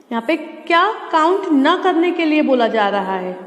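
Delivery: ordinary talk, nothing marked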